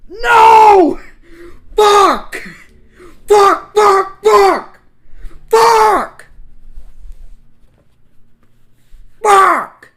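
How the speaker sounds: high, full-force shrieked swearing; howling in despair; anguished screaming. Real, not acted. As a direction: scream each word separately, at the top of your voice. Player reacts in anguish to story.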